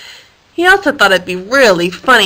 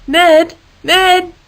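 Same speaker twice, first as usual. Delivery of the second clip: higher, calling up; 8 semitones higher, 3 dB louder than usual. Acted. Harold calling to Ned on the wire, raised call. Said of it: "Harold called out to him".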